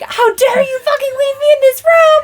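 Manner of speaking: high, outraged mock yell; retold yelling. Retelling the yell at partner from trapped room, high mock yell. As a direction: yell it as a scandalized, wailing accusation. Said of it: "I was yelling at him".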